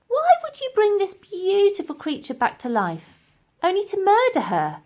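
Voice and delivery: put-on high, eager young girl; girl's voice. Elsie urging the professor, high girlish voice.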